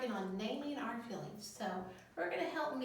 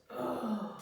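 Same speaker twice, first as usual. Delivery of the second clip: grumbly ugh; 6 dB louder than usual. Shown as a demonstration. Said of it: "people are grumbling".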